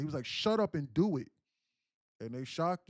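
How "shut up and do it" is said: loud, higher, forceful command; frantic order at full voice; retold scream. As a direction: shout down an objection, acted out. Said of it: "And he screamed at the ambulance".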